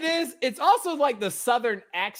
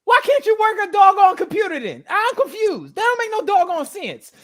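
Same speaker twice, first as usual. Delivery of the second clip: exasperated; 7 semitones higher, 5 dB louder than usual. Real, not acted. Mock outrage at her phone trouble.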